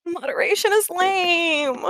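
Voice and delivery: put-on high, slow, sulky kid whine; whining. Sick child talking back to Epic, whining. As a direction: drag it out like a sulking child. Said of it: "he's sort of, like, whining".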